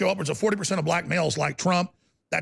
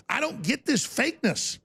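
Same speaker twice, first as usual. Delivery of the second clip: ordinary delivery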